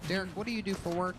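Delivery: ordinary delivery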